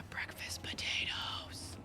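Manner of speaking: breathy unvoiced whisper, slow; slow whispered aside. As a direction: hush it like a secret. Whispered aside about potatoes.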